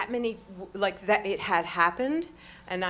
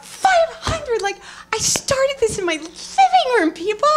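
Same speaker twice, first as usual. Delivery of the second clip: high, loud, incredulous exclamation; excited shouting; 14 dB louder than usual. Real, not acted. Woman telling audience how big her class grew, excited shouting. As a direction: shout it out, punching each word.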